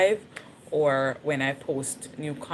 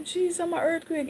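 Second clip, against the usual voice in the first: much higher, panicked call to Jesus; alarmed cry; 13 semitones higher than usual. Retold or imitated. Telling viewers the earthquake woke her, panicked high cry. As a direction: cry out as the ground starts shaking. Feeling the ground move.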